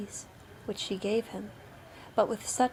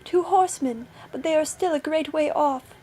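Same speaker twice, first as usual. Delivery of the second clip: high, raised lookout voice; sister on lookout; 6 semitones higher, 6 dB louder than usual. A narrator's reading. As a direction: report down from the tower. Sister reports horsemen.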